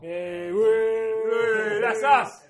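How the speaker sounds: loud high whooping cheer; excited cheer. Real, not acted. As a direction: cheer like you won. Finding their podcast listed.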